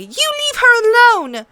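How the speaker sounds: put-on young sister shouting in defence; angry shout. A narrator's reading. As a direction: yell it at the queen. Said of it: "Arya shouted angrily".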